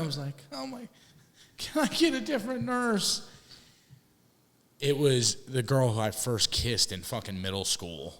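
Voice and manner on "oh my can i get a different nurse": high-pitched, mortified retold plea; mock distressed plea. Patient recognises his nurse from middle school, mock distressed plea. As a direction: plead up high, half joking. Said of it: "crying and just, like, depressed".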